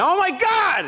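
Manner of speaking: a dog owner shouting in panic at the chocolate; alarmed shout. Retold or imitated. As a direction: cry out in shock at the dog. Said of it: "and I went".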